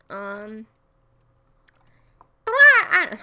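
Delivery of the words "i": very high, loud cartoon voice; failed high-pitched cartoon impression; Mickey. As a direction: squeal it in a cartoon falsetto. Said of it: "I was gonna try to do Mickey".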